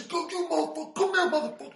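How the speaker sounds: high, loud furious yelling; big man cussing at the teller, high and loud; imitated cussing. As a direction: roar it at him like a raging giant. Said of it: "He's cussing at me".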